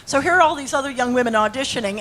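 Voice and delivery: ordinary talk, nothing marked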